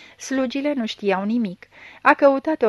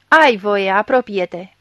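forceful, official permission; loud command; 8 dB louder, slower than usual. A narrator's reading. Gendarme lets blind suspect approach the body, loud command. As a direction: order the suspect forward.

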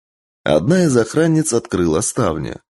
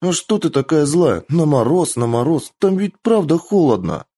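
put-on girl voice; 7 semitones higher than usual. A narrator's reading. Kitsune voiced, high.

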